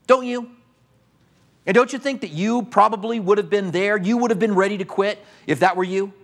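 ordinary talk, nothing marked

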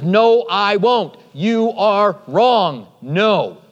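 slow, word-by-word "You are wrong"; emphatic refusal. Acted. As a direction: draw out "No" and "wrong", each word landing separately. Peter's acted refusal of Jesus.

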